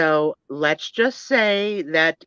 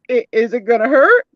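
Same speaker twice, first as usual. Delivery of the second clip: nervous patient; 7 semitones higher, 9 dB louder than usual. Acted. Nervous patient doll asks.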